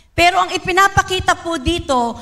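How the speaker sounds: ordinary talk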